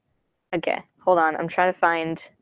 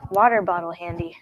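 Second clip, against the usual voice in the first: ordinary talk, nothing marked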